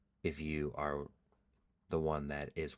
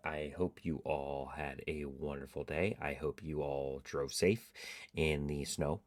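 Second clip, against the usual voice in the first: usual speaking voice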